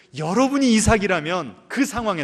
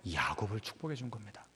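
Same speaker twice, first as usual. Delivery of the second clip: whisper; 8 semitones lower, 16 dB quieter, faster than usual. Real, not acted. Preacher whispering to the congregation. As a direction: drop to a whisper.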